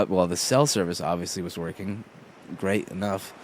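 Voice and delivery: ordinary talk, nothing marked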